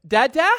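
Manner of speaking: "Dada?" as a loud, high, rising question; "Dada?" called out high, lifting sharply at the end; questioning toddler. Retold or imitated. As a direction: imitate a small child asking "Dada?", pitch shooting up. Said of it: "He'll kind of say it as a question".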